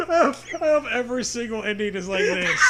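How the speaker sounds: fast, giggling delight; laughing. Real, not acted. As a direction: say it while cracking up.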